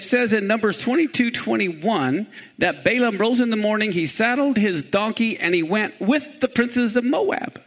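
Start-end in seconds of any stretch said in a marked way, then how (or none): none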